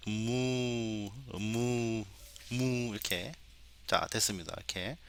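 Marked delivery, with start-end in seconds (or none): 0.1-2.9 long moo with a short closed-lip onset; cow moo imitation. Shown as a demonstration. Teacher varying the cow samples, imitated mooing. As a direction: moo like a cow in a varied pattern. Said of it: "좀 패턴이 다르게".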